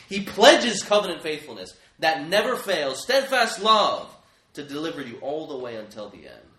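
ringing out each promise loudly; forceful proclamation. Real, not acted. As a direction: proclaim it with full preaching force. Preacher proclaiming God's faithfulness.

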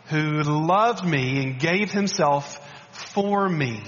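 very slow, weighty, loved stressed; slow emphatic reading. Shown as a demonstration. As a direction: let each word of the verse sink in. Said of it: "I want you to listen to this verse".

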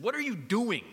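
half-reenacted angry dad; retold angry yell. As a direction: snap at the kid like a frustrated coach. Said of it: "I'm angry".

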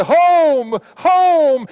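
high, loud, drawn-out longing call; yearning cry. Pretend. Preacher voicing a believer in distress, soaring yearning cry. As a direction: lift each home like a hymn.

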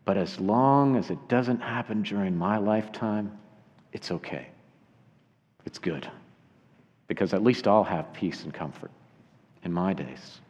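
low, hushed self-reassurance; inner thought. Acted. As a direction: let it sink to a private, quiet murmur. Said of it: "he was thinking in his mind".

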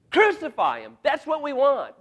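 loud, high crowd shout; crowd cry. Acted. Mob demanding Pilate crucify him, shouted.

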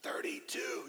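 loud stage whisper, no voice; the loudest whisper you can do. Shown as a demonstration. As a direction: push the whisper out so all can hear. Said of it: "the loudest whisper that I know how to do".

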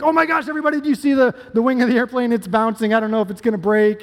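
high, hammed-up panic in the cabin; mock panicked yelling. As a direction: pretend to freak out about the wing. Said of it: "start yelling".

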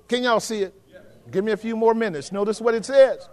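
ordinary delivery

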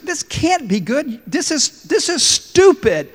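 high, slow angry outburst, loud at the end; king raging at his friend; furious king. Acted. King with a lost thumb, yelling at his friend in fury. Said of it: "the king is furious".

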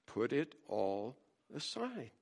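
tossed off matter-of-factly; dry answer to the whiner; deadpan reply. Pretend.

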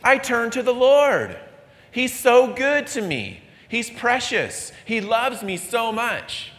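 high, earnest churchgoer voice; a hint of a sweet pious voice; pious believer. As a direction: play the devout believer, lifted high. Mock pious believer quote.

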